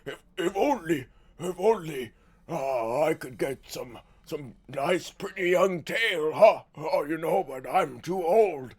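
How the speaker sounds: lightly voiced lecherous old man; a hint of a dirty old lord. Mocking the voice actor, lightly played old lech. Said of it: "knowing it's just".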